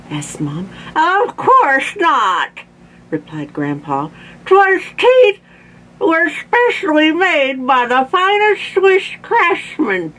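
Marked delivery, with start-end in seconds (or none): 1.0-2.6 put-on high, drawn-out old man's retort; slow, high-pitched protest; indignant toothless Grandpa. A narrator's reading. 4.5-10.1 put-on high toothless old man voice; high-pitched, mushy indignant protest; Grandpa talking funny. A narrator's reading. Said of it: "Why is Grandpa talking funny?".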